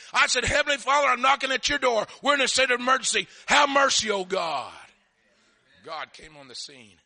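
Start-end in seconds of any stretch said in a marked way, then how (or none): 0.4-4.7 loud, high pleading shout; desperate prayer. Retold or imitated. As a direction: cry out to heaven in an emergency. Acts out his desperate shouted prayer.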